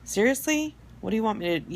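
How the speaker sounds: high, loud disbelieving "seriously?"; incredulous. Retold or imitated. Driver reacting to honking cars, incredulous and high. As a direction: toss it out in exasperated disbelief. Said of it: "It's like".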